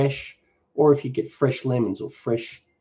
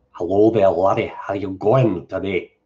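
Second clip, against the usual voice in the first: sort of Scottish accent; 3 semitones lower than usual. Shown as a demonstration. Streamer answering a viewer's request for an accent, sort of Scottish. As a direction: attempt a Scottish greeting that turns weird at the end. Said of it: "That's sort of Scottish".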